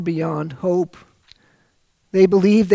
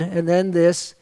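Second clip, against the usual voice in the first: ordinary delivery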